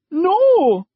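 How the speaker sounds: put-on very high, loud girl's scream; girl screaming as he grabs her. A narrator's reading. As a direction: scream no with all you have. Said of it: "she screamed".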